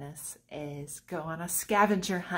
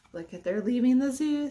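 usual speaking voice

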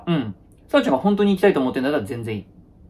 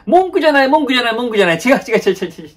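much higher and louder, insisting; defensive denial; 9 semitones higher, 5 dB louder, much faster than usual. Acted. Telling his girlfriend he isn't complaining, flustered and insisting. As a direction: wave off the accusation in a rush.

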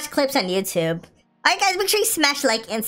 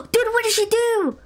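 shocked high exclaim; 7 semitones higher than usual. Real, not acted. Shocked at Megan's attack.

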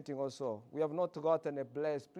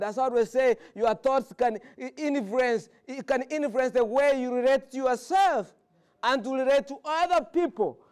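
louder, higher lively preaching; 5 semitones higher, 7 dB louder than usual. Acted. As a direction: carry the whole church with you. Sermon rising in intensity.

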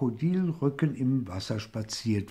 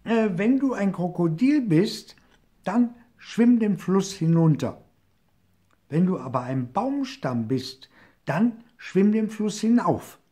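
higher, louder cheeky call; 8 semitones higher, 5 dB louder than usual. A narrator's reading. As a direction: challenge the crocodile across the water. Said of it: "und rief". (hoher, lauter, frecher Ruf)

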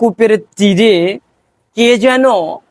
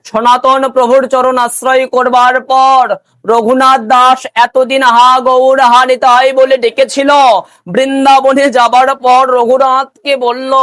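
raised, sustained devotional storytelling; preaching; 5 semitones higher, 3 dB louder than usual. Acted. Preacher telling devotees of Raghunath in Vrindavan, intoned preaching. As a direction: preach it out in long intoned phrases.

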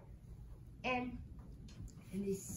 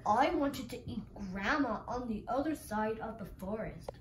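lightly voiced wolf, pitched down; 4 semitones lower, 3 dB quieter than usual.

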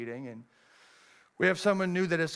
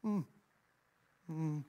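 ordinary talk

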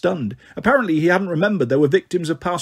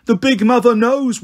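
terrified ghost; 7 semitones higher, 4 dB louder than usual. Acted.

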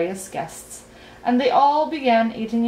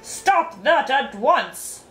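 slow, higher, commanding storybook roar; thundering; 5 semitones higher, 3 dB louder, much slower than usual. A narrator's reading. King to his scuffling guests, thundering a slow order. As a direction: thunder it out word by word. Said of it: "thundered Azaz".

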